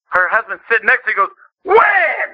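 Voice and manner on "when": high, shouted one-word challenge; loud retort. Retold or imitated. Husband beside testifying wife at camp meeting, loud retort. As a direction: blurt it out incredulously for all to hear. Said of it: "Loud, we could all hear".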